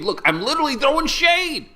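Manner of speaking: high, loud goofy delight; excited joke. Real, not acted. Vlogger showing off his shadow to viewers, excited joking. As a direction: push the pun up high and loud.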